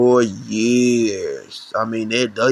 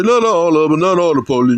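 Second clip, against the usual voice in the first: higher, louder mocking voice of a naive defender; mock objector; 5 semitones higher, 4 dB louder, faster than usual. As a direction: parody someone protesting "not all the police". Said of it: "Some people say".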